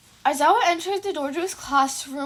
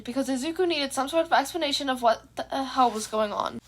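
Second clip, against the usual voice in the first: usual speaking voice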